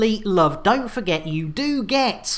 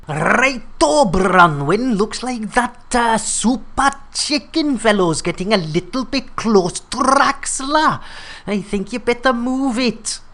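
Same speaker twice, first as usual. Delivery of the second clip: put-on Cornish accent. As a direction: boss voice in a jokey Cornish accent. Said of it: "Yeah, great Cornish accent".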